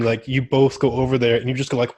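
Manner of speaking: normal talking voice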